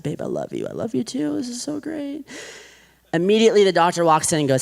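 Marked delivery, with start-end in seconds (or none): 0.0-0.7 deep, low mock husband voice; lightly hammed-up husband; husband's line. Husband voiced in retold hospital scene. 0.7-2.2 high mock wife voice, pitched well up; hammed-up wife voice; wife's reply. Wife voiced in retold scene.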